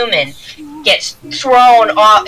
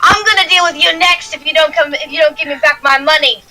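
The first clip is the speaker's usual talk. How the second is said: angry dwarf woman; 9 semitones higher than usual. Acted. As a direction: threaten them as a feisty dwarven fighter. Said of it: "she looks off and she says".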